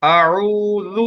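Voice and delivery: आऊज़ु drawn out very slowly with a soft ज़; slow soft demo. Shown as a demonstration. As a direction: recite आऊज़ु slowly and softly for imitation. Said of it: "लाल को नरमी से पढ़ना है". (आऊज़ु बहुत धीरे और नरमी से)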